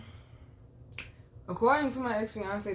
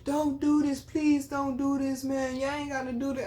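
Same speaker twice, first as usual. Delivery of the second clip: high-pitched, pleading whine, imitated; begging; 7 semitones higher than usual. Ex-fiance pleads with officers as he is brought in, begging. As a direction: mimic a man pleading over and over. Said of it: "he's literally begging them".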